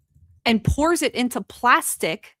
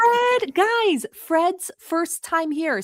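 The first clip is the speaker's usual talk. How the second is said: much higher, delighted announcement; excited greeting; 9 semitones higher, much slower than usual. Real, not acted. Streamer excitedly welcoming a first-time viewer to chat, high and delighted. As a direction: gush over the new viewer.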